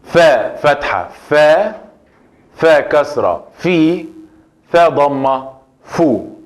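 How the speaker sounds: práctica de vocales; vowel drill. Shown as a demonstration. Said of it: "media o final".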